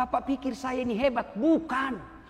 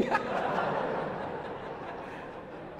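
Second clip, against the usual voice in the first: unvoiced laugh; 8 dB quieter than usual. Real, not acted. Laughs at his own joke.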